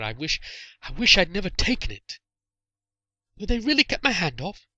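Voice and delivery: put-on high boy's voice, halting and contrite; remorseful boy. A narrator's reading. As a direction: confess guiltily.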